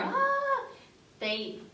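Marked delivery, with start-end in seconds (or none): 0.0-0.6 sudden high loud frazzled "ah"; the sound of a mom losing her cool; high panicked mom cry; stressed mom yelp. Shown as a demonstration. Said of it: "So sometimes when you're".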